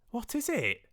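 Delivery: very high, eager question; squeaky-high cry from Fred; excited cry. Acted. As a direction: demand the answer in delight. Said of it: "cried Fred".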